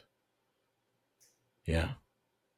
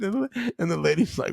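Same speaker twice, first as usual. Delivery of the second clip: ordinary delivery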